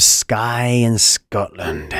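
a hammed-up, slow bad Scottish accent; a mock Scottish lilt, badly done; terrible Scottish accent. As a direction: put on a clumsy Scottish accent for the place name. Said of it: "It's a terrible accent".

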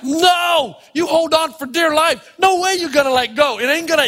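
high-pitched, frantic refusal; acted panic, clinging on for dear life; mock panic. Acts out clinging to the rope.

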